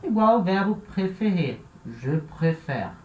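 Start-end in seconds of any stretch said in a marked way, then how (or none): 1.9-2.9 "préfère" with closed first E, open second; mesmo E aberto. Shown as a demonstration. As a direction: say "préfère" slowly, ending in open "fehr". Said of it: "É a mesma coisa". ("préfère" devagar, com o último E aberto)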